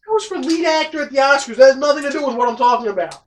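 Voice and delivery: frustrated. Real, not acted. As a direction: lose patience explaining. Frustrated explaining to partner.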